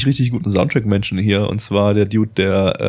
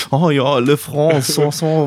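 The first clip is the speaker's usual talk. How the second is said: higher, slow mock French babble; fake French; 5 semitones higher, slower than usual. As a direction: ham up a made-up French. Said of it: "wie so ein Fake-Französisch".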